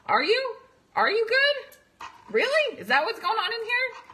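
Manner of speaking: high, incredulous questioning; skeptical challenge. Pretend. Coach to daughters faking fine, high disbelieving probe. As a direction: call out the lie with disbelief.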